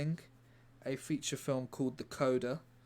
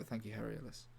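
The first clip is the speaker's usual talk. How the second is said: usual speaking voice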